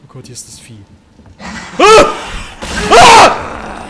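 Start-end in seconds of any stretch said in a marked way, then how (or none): 1.4-3.8 very loud, high shrieks; terrified scream. Real, not acted. Player attacked by the creature, high screaming. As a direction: scream out in sudden fright.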